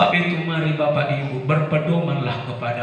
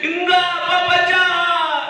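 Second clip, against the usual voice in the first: put-on child; 4 dB louder than usual. Child refuses father.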